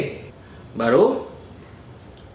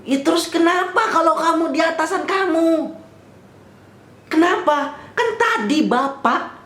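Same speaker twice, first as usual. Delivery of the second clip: much higher-pitched, acted-out protest; indignant retort; 10 semitones higher than usual. Silently answering the officer back, acted indignant protest. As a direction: throw back an exasperated challenge.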